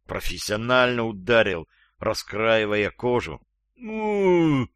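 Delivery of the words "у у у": high, drawn-out moan through a gag; muffled moan. A narrator's reading. Gagged captive struck on the jaw, high muffled moan. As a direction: moan in pain with mouth shut. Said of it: "замычал Шустрый".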